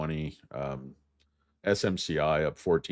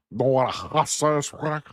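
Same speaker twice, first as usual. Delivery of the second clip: put-on loud, high, garbled Cuban-style accent; hammed-up Scarface-like accent, pitched high; muddled fake Cuban accent; 10 semitones higher, 5 dB louder than usual. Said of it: "Are you supposed to be Cuban".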